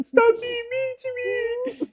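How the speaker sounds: very high, slow whimpering voice; mock whiny crying. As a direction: mimic girlfriend's tearful whine. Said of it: "she was like".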